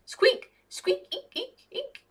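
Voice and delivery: very high squeaking chatter, fading; excited high rat chatter; high rat squeaks and eeks. A narrator's reading. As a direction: squeak away like a tiny rat telling a story.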